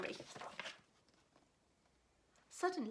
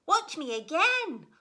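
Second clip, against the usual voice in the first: higher and louder; eager call; 6 semitones higher, 11 dB louder than usual. Acted. Gooseberry calling to Red Squirrel, eager loud call. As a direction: beg for attention. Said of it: "said Gooseberry".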